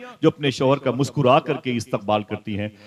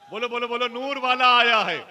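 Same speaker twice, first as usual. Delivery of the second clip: urging slogan; 11 semitones higher than usual. Real, not acted. Preacher urges congregation to repeat the slogan, rallying call. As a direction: spur the crowd to chant along.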